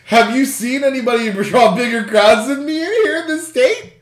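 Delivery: high, loud, laughter-tinged question; laughing while reading. Real, not acted. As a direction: read the quote through real laughter. Laughing while reading a quiz quote.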